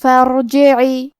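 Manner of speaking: slow فرجعی without a glottal stop, both kasras even; tajweed demo. Shown as a demonstration. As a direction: chant فرجعی slowly. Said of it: "دونوں زیر کو برابر سا ادا کرنا ہے".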